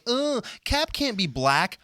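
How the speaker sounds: mock the complaining fans; whiny fan voice. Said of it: "people like".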